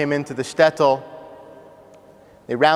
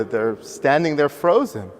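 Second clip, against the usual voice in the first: usual speaking voice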